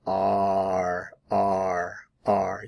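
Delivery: R held out on its own as a sound; the ar sound of are, repeated; isolated R vowel. Shown as a demonstration. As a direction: drill the R sound alone. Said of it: "Notice the vowel sound".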